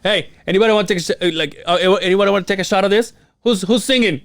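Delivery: high, fast hollering barfly; imagining himself as the bar guy, high and fast; mock bar guy. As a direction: rattle it off like the eager bar guy. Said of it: "I go".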